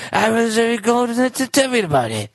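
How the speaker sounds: Arthur impression. Co-host keeps the joke going in a high cartoon voice. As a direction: do a silly Arthur voice. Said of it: "Sounds like fucking Arthur".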